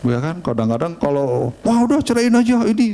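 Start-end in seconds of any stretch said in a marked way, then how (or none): 1.7-2.9 kutipan kesal bernada lebih tinggi; pertengkaran suami istri. Acted. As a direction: tirukan pasangan berantem dengan nada naik. (meniru orang bertengkar, nada tinggi)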